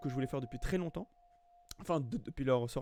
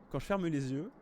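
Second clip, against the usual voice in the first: ordinary delivery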